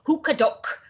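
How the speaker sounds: rough imitation of a North Eastern English accent. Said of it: "rich North Eastern accent".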